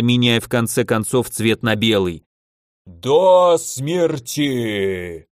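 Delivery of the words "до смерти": extremely slow monster roar; murderous elemental. A narrator's reading. Elder elemental threatening the mages, slow monstrous roar. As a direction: stretch each syllable, giant's threat.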